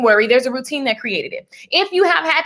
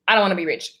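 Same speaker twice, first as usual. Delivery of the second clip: dropped lower, dismissive about-face; mock refusal; 6 semitones lower, much faster than usual. Acting out flip-flop energy, mock low refusal. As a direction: drop down for the contrary side.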